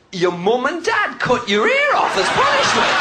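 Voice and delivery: slow, high Geordie shout; mock Geordie heckler. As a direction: call it out like a Geordie at the back. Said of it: "little Geordie guy".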